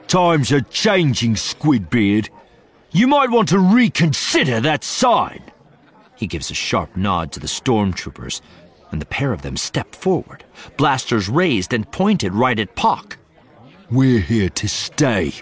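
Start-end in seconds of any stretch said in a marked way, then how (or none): 0.1-5.4 loud, high mocking thug; taunting oaf. A narrator's reading. Oafish officer taunts bartender. 13.9-15.3 menacing thug voice. A narrator's reading. Officer's menacing declaration.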